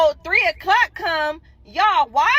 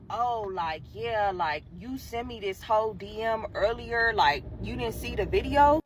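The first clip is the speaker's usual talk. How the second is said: much lower and softer lazy dude; lower, quieter offhand reveal; smug guy voice; 5 semitones lower, 5 dB quieter than usual. Retold or imitated. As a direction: play it low and smug. She voices the guy again.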